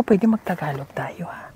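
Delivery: soft coaxing whisper. Real, not acted. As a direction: whisper it gently to the student. Coaxing student softly.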